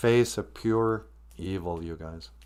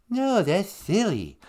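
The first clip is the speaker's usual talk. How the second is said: slow, swooping fake puppet voice; Big Bird; 3 semitones higher, slower than usual. Caller insisting to the host, put-on slow bird voice. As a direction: stretch it out like a silly children's-show bird. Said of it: "don't think you're the original Big Bird".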